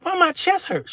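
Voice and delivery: high breathless whine; comic distress. Retold or imitated. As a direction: complain in mock pain. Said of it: "I was like".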